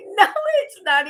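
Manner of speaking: high, loud indignant denial; retold protest. Retelling arguing with Peter, mock indignant high protest. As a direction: squeak out a shocked denial. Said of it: "I'm like".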